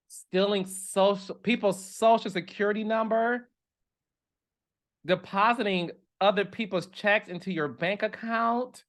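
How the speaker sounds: ordinary talk, nothing marked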